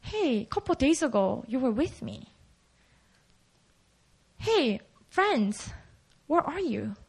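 soft, hesitant, lonely plea; forsaken Jesus. Acted. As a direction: quietly call out to absent friends. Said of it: "what Jesus must have felt".

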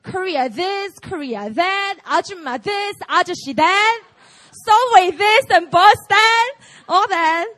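loud, high rattled-off list of gripes; mock complaining. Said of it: "just to point out what's bad".

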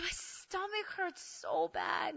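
high, soft, slow whine; hammed-up stomach agony; in pain. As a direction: whimper through a mock cramp. Acts out her stomach agony.

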